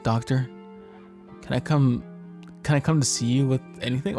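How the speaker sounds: ordinary talk, nothing marked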